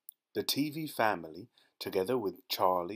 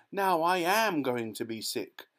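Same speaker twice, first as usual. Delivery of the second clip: higher, louder wailing protest; queasy yell; 6 semitones higher, 3 dB louder than usual. A narrator's reading. Mrs Teavee yelling at Mr Wonka, higher and queasy. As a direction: moan it as she gives up. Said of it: "yelled Missus Teavee".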